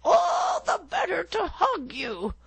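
very high, strained fake granny voice; the wolf in bed faking granny's voice. A narrator's reading. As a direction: squeak like a wolf pretending to be granny.

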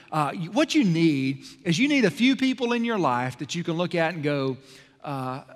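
ordinary delivery

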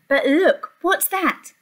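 ordinary delivery

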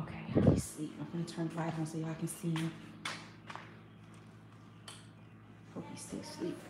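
whisper-soft, barely voiced. Real, not acted. Baby asleep nearby.

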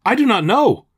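high, loud, urgent answer; quick reply. A narrator's reading. Elijah answers the butler about Miss Lucy, quickly blurting. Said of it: "Elijah quickly says".

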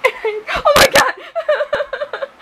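high, loud panicked laugh-scream; terrified shriek. Real, not acted. Alone in her room with a moth, a loud panicked shriek. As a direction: shriek in real fright at the moth. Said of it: "I'm terrified!".

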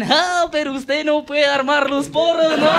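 high, slow, mocking voice; mocking stoner joke. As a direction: stretch the joke out slowly and smugly. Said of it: "me dicen".

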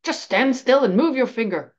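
annoyed. A narrator's reading. Annoyed professor ordering a student to stand still, exasperated. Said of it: "becoming annoyed".

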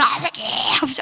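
high-pitched retold cry. Child reunited with weeping father, crying out. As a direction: call out as if bursting into tears. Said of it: "막 그냥 막 울고".

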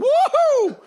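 loud, very high mock-scared whoop; frightened yelp. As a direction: shriek as if an angel popped up next to you. Imagining an angel appearing beside him.

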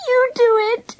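high, pleading voice; beg, pitched right up; begging. A narrator's reading. Panicking girl pushing the job on her friend, begging. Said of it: "she begged".

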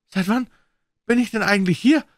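high, loud frightened gasp of a question; hoarse blurt. A narrator's reading. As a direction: force it out in panic. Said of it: "stieß Bollem heiser hervor".

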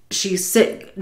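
ordinary talk

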